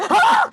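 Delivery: short, very high mock scream; imitated scream. Storyteller acting the shocked doctor's reaction, mock scream. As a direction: imitate a startled shriek, laughing. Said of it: "He screamed out loud".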